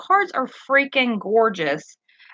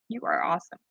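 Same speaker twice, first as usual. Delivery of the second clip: much softer, lower "You are awesome" said to oneself; soft repeat; 5 semitones lower, 9 dB quieter, faster than usual. Real, not acted. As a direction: let "You are awesome" fall back quietly.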